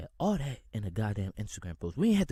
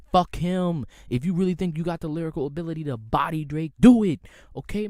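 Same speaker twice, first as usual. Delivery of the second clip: loud, higher shouted challenge; heated callout; 5 semitones higher, 10 dB louder than usual. Real, not acted. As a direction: yell the dare at the rappers. Ranting callout to rival rappers.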